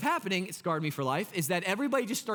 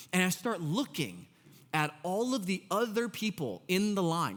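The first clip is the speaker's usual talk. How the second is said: ordinary talk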